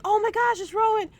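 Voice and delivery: high, loud squeal of shock at the surprise; excited squeal. Retold or imitated. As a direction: squeal it out, thrilled and stunned. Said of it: "I was like".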